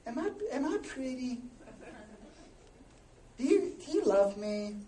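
hesitant, halting mock plea; lightly voiced insecure wife. Wife fishing for reassurance from husband, mock timid. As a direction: play a needy wife, gently and haltingly.

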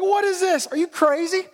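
high-pitched incredulous jeer; acted scornful disbelief; mocking. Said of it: "starts mocking him".

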